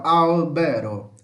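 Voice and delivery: slow "Albero", punching the AL; first-syllable stress. Shown as a demonstration. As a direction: demonstrate "Albero" as AAL-be-ro, drawn out. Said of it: "Mais forte é a primeira sílaba". ("Albero" devagar, com a primeira sílaba forte)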